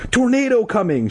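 high, urgent warning cry; mock shout. A narrator's reading. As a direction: warn a crowd in a high voice. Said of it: "she shouted".

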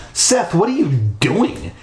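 high, indignant question; angry demand. Acted. Girl confronting the boy who locked them in, angry demand. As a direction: demand it crossly, voice swooping up. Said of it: "she demanded angrily".

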